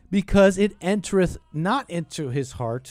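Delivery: loud soapbox preaching; street preacher. Acted. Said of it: "I'm talking like a street preacher".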